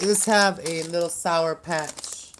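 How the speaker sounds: ordinary delivery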